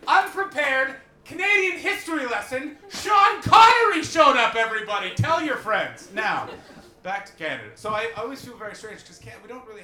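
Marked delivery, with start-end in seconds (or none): 0.1-6.1 high, loud promoter's boast; hype shouting. Acted. Comedian plugging his free show to the crowd, shouted hype.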